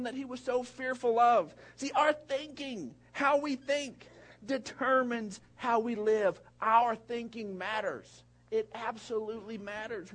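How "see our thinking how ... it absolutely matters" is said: preaching. Acted. Preaching the point.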